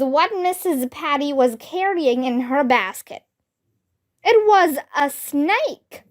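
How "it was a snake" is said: ordinary delivery, nothing marked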